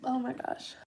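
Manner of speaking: breathy, worn out after coughing; hoarse after coughing. Real, not acted. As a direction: gasp it out after a fit. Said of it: "I was coughing so bad".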